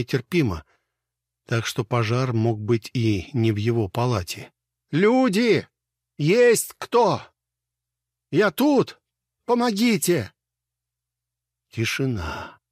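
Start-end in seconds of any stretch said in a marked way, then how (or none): 4.9-10.3 high, loud desperate cries; frightened yells for help; calling for help. A narrator's reading. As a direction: shout into the silence for rescue. Trapped man calling for help.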